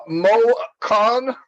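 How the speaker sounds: ordinary talk, nothing marked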